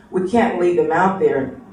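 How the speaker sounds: usual speaking voice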